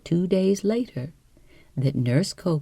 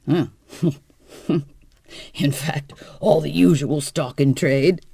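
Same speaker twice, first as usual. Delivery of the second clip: cheerful laugh; 5 dB louder than usual. Acted. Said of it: "said George cheerfully".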